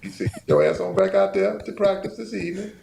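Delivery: high, loud, scolding mom voice; scolding mother. Retold or imitated. Mother ordering her son back to practice, high and loud. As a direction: tell him off like his mama. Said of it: "Did she say it like that?".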